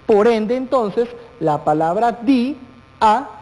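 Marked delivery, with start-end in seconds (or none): 2.2-3.3 "di, a" pulled fully apart; syllable split. Shown as a demonstration. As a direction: say "di", hold it, pause, then "a". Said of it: "se tiene que separar por completo". ("di, a" lento, con las vocales totalmente separadas)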